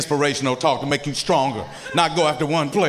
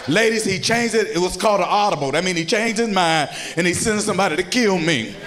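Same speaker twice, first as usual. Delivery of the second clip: ordinary talk, nothing marked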